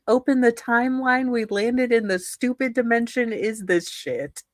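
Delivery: ordinary delivery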